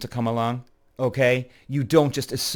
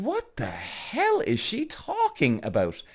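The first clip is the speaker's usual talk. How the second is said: high, slow, stretched-out disbelief; incredulous; 8 semitones higher, 3 dB quieter, slower than usual. Retold or imitated. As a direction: draw the question out in high-pitched bewilderment. Retold bafflement at being blocked.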